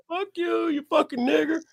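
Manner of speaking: high-pitched, held-back mock taunt; hostile stranger. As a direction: sneer it high, not yet at full volume. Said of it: "He's like".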